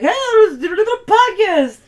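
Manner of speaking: very loud, high, slurred outburst; mock drunken shout. Wife mimics one of the group suggesting recording, mock drunken yell. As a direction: imitate a rowdy drunk yelling an idea.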